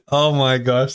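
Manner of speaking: normal talking voice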